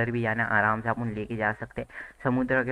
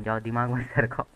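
ordinary talk, nothing marked

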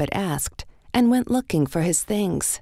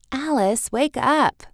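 higher, louder urgent wake-up call; sister calling; 8 semitones higher, 4 dB louder than usual. A narrator's reading. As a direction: rouse her from the nightmare. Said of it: "her sister said".

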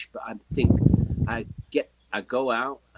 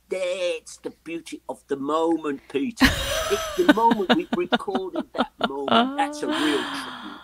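Mickey's voice; 4 semitones higher, 4 dB louder, slower than usual. Retold or imitated. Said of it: "He said".

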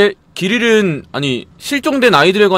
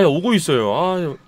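ordinary delivery

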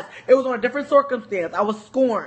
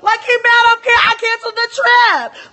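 mocking shout; 11 semitones higher, 8 dB louder, faster than usual. Continuing the mocking rant.

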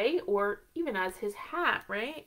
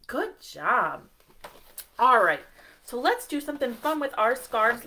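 ordinary delivery

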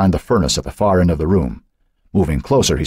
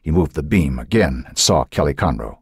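ordinary delivery

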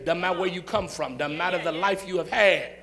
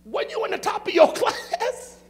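laughing disbelief; 14 semitones higher, 6 dB louder than usual. Retold or imitated. Pastor retells disbelieving question.

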